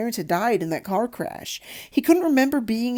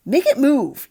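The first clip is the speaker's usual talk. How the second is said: put-on spoiled child whining, higher and louder; 7 semitones higher, 8 dB louder than usual. A narrator's reading. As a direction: whine it like a bratty kid demanding a treat. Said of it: "he whined at his father".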